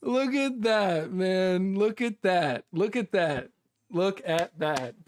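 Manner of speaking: amazed excitement. Real, not acted. Streamer shows viewers a rare drop, amazed excitement. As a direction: marvel at a lucky win.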